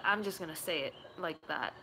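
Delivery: ordinary talk, nothing marked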